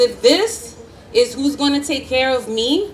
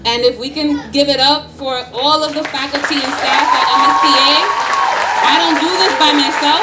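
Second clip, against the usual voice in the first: rallying call; 2 semitones higher, 5 dB louder than usual. Real, not acted. Calling crowd to applaud staff.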